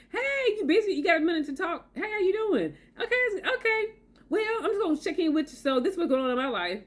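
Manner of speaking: high, friendly phone check-in; mock considerate caller. Imagined caller asking if she has a minute, mock-polite and high. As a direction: chirp a polite greeting, pitched well up.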